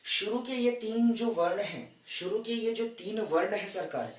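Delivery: ordinary delivery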